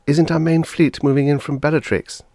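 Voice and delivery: a worried wife seeking comfort; anxious flutter. A narrator's reading. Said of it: "fluttered anxiously".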